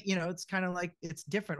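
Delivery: normal talking voice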